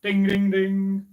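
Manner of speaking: slow sung ding of small bells; bell imitation. Storyteller imitating the small bells, slow sung dings. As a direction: ring it out like a tiny bell. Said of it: "the bells rang".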